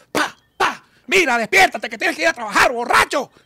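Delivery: high, loud scolding wife voice; wife shouting at her drunk husband to get up for work; angry wife. Acted. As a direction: yell him awake.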